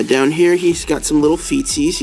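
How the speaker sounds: ordinary talk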